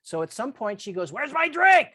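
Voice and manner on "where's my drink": raised, loud demand for a drink; retold yell. Boss yelling from the living room for her drink, loud. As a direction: call out impatiently from the other room. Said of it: "You know, she's yelling".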